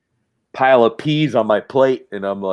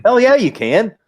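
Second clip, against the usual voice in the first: higher and louder, eager yes; excited yell; 11 semitones higher, 6 dB louder than usual. Pretend. Collector to a pleading toy, an excited yell. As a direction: shout it out with glee. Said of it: "we're like".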